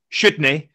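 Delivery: "Shouldn't he" said fast as "shudn e", the t and n run together; slurred Scottish "Shouldn't he", the t dropped. Shown as a demonstration. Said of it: "There is no word, shouldn't he?".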